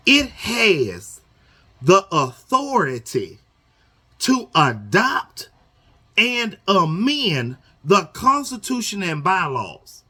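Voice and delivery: slow, stretched reading of the bylaw. Shown as a demonstration. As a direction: read each word out slowly. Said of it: "I'ma read this slow".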